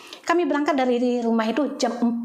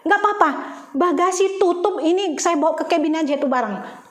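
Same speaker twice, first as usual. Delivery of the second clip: eager insistence; 7 semitones higher, 3 dB louder than usual. Retold or imitated. Insisting to carry bags on.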